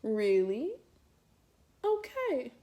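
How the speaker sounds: dry, let-down disbelief; disbelieving. Retold or imitated. Reacting to sister's shrug, dry disbelief. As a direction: react like you can't believe her. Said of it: "I was just like".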